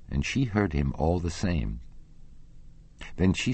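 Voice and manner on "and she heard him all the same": ordinary delivery, nothing marked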